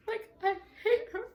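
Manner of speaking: high, laughing through tears; crying laugh. Retold or imitated. Telling how she cried after the beating, high laugh-cry. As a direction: choke it out half laughing half crying. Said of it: "And I'm crying".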